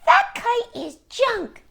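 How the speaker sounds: high, slow robin taunt; sing-song high bird mockery; jeering robin voice. Robins teasing Toad from the bush, high slow taunt. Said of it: "said the robins".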